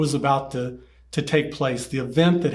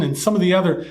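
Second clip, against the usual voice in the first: normal talking voice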